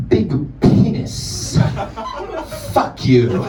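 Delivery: very slow, leaning in close; a silent kid finally speaking, slow and sly; deadpan student. Retold or imitated. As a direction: drag it out conspiratorially. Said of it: "And he leans in and he goes".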